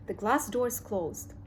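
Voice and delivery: ordinary delivery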